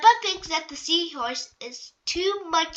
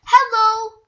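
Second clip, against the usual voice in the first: bright hello; 7 semitones higher, 9 dB louder than usual. Acted. Miss Rabbit greets Peppa.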